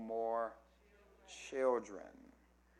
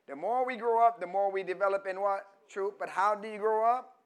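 loud, high, insistent sermon voice; full-force preacher, pitch raised; preaching; 6 semitones higher, 9 dB louder than usual. Real, not acted. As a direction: press the congregation with each phrase.

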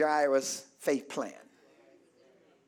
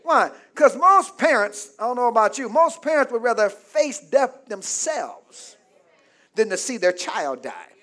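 normal talking voice

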